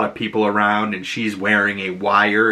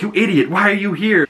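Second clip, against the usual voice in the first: annoyed; 9 semitones higher than usual. Retold or imitated. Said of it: "And she's like".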